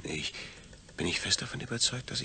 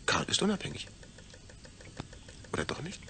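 ordinary talk

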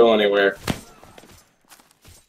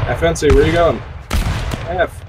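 calling out; 8 semitones higher than usual. Real, not acted. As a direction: shout after a wandering teammate. Calling out to teammate.

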